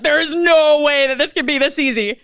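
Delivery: half-laughing amazement; stunned disbelief. Retold or imitated. Disbelief at the easy sale.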